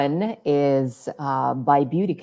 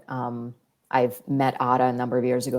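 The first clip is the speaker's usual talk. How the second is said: ordinary delivery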